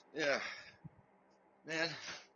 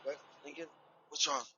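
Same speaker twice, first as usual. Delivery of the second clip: concerned call to dog; 5 semitones higher, 6 dB louder than usual. Real, not acted. Asking his barking dog what's wrong.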